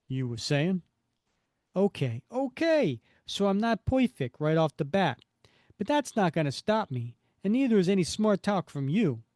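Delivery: put-on loud, defiant Brooklyn-style accent; Roscoe. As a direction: talk back in a broad comic-book Brooklyn accent. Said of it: "I am not giving him this accent".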